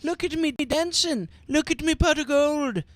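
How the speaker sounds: high squeaky Irish leprechaun. Acted. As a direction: play the tempting leprechaun, high. Voicing the leprechaun courting.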